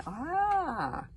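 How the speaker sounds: long, high, loud "ah"; drawn-out cry of revelation; dawning realization. A narrator's reading. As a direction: draw out the discovery. Dragon's sudden realization.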